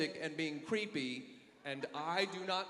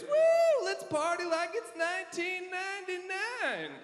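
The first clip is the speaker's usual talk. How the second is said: high whooping party bro; 12 semitones higher, 4 dB louder, slower than usual. Retold or imitated. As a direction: whoop it up like a drunk buddy. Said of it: "my buddy said the phrase".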